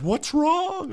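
high-pitched worried wife; wife's voice. Retold or imitated. Wife running in asking about the yelling, high and worried. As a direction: squeak it like the startled wife. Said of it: "the wife came running and said".

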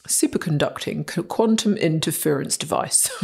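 plummy upper-class English accent; posh voice. Shown as a demonstration. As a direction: say it like a posh British presenter. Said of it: "You have to say it in the posh voice".

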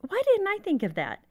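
mock dismay at her son thinking of it first; self surprise. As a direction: act out kicking yourself for missing it. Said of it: "And I was like".

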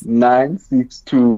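very slow, careful digits; slowly. Shown as a demonstration. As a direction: drag out each number. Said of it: "let me repeat slowly".

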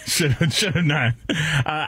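ordinary delivery

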